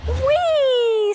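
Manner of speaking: high, loud delighted squeal; little boy's thrilled whee; gleeful whee. Acted. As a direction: shriek with joy on the swing. Boy swinging on curtains.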